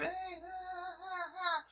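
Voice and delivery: very high, hushed falsetto imitation; soft and sensual. Mimicking how Kevin talks all day, soft and sensual. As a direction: put on a soft, sultry falsetto. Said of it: "Real soft and sensual".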